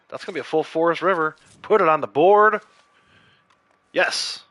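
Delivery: loud, high, delighted announcement; excited call-out. Real, not acted. Streamer celebrating a bull moose find, excited and loud.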